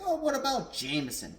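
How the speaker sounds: high, loud imitated question; exaggerated, high-pitched "what about" protest; mock objection. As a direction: mimic everyone piping up about another brand. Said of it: "everyone was like".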